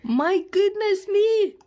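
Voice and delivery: high, slow and loud gasp of wonder; amazed. Real, not acted. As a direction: marvel at it openly. Amazed at the doll's house.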